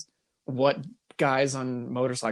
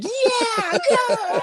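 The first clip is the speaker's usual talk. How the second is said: much higher, laughing cheer; mock cheering; 4 dB louder than usual. Sketch guys cheer revving biker, high and laughing.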